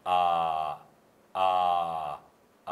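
「あー」を顎を大きく下げて大きめに; 口を縦に開き顎を落として; 下顎を落とした「あー」. Shown as a demonstration. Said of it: "あーぐらいちょっと落としていただいてもいいですか". (顎を落とした大きな「あー」)

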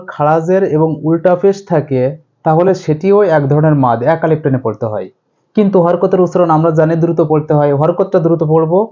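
usual speaking voice

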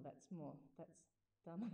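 normal talking voice

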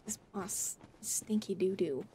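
soft muttering to herself; muttering quietly. Real, not acted. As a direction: mutter under your breath. Muttering to self in fight.

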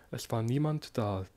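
plain reading voice

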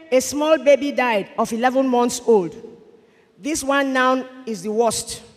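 ordinary talk, nothing marked

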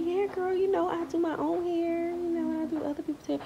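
put-on high, soft girly voice; a hint of breezy girlfriend; mild high sing-song quote; lightly voiced Tammy.

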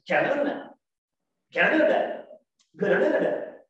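"Kata" said crisp with a rising end, like a Greek speaker; Greek pronunciation. Shown as a demonstration. Pastor showing the congregation how a Greek says "Kata". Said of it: "when a Greek would say it, it sounded like this".

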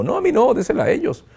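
mayor brushing off thanks, voice pitched high; mayor's modest reply. Retold or imitated. Mayor deflecting the priest's thanks, high modest voice. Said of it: "y me dijo".

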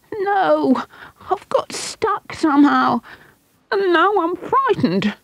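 put-on high frightened boy, close to crying; near tears. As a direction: voice the scared boy almost in tears. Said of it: "sounding almost as if he were going to cry".

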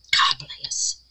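soft, voiceless exclamation; whispered to self. Real, not acted. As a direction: whisper it under your breath. Muttering to herself over overpour.

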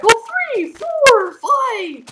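very high, loud panicked counting; shrieking count. Real, not acted.

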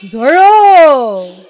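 loud, drawn-out cry of the name; excited call. Real, not acted. Fan spotting Zoro, loud excited call. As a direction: call out his name with delight. Favourite character appears.

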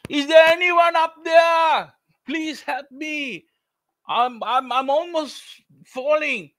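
shouted, slow and high, begging from a cliff; desperate scream for help. A narrator's reading. Jack dangling from a bush, screaming for help above. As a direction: yell up the ravine, clinging on. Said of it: "he's screaming".

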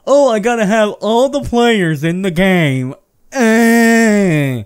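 mocking idiot voice, drawn-out ending; mock a clueless player, stretching the last sound; whiny idiot player. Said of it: "idiots who's like".

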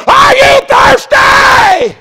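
high, drawn-out preaching shout; shouted appeal. Real, not acted. Preacher demands whether the congregation is thirsty, shouted appeal. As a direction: belt it slowly at the congregation.

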